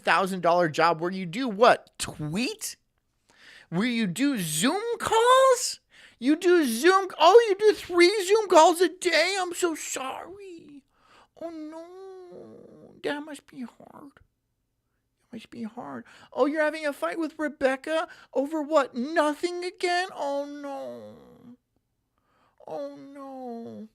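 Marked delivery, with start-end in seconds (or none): 3.7-12.9 much higher, louder fake pity; sarcastic high sing-song pity; mock sympathy. 13.0-14.1 higher sarcastic pity; sarcastic high cooing; mock pity. Sarcastic fake sympathy. 14.1-16.0 slow, breathy whispered fake pity; slow sarcastic whisper; mock whisper. 16.3-23.9 higher, sing-song fake concern; sarcastic high cooing pity; mock sympathy.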